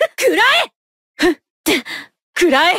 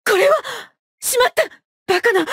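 high-pitched alarm; sudden dismayed cry; startled; 6 semitones higher, faster than usual. Acted. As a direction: react as if caught off guard in battle. Caught out in battle.

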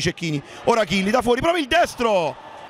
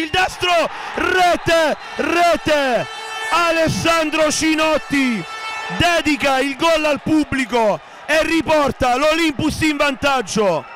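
breathless italian goal commentary; 4 semitones higher, slower than usual. Real, not acted. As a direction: call the winning goal. Winning goal in extra time.

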